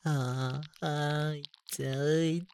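high, rising moans; climax moans. Acted. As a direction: let the moans climb.

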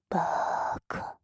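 slow, voiceless whispered taunt; teasing whisper. Pretend. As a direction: stretch the insult out in a whisper. Whispered teasing insult after laughing.

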